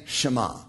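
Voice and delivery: "Shema." said as shuh-MAH, stress on the last syllable, falling; Hebrew word Shema. Shown as a demonstration. Teacher giving the Hebrew word for hear, shuh-MAH. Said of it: "She would say".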